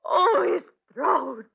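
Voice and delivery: slow, high, crying out in horror; horrified cry. Acted. Wife sees the servant's torn throat.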